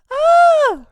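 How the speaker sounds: long, loud, very high squeal; effeminate yelp. Retold or imitated. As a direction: re-enact an embarrassing high-pitched scream. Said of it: "It was the gayest".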